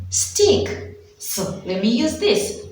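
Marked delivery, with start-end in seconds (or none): 0.1-1.0 sudden bright answer. Shown as a demonstration. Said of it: "What am I saying?".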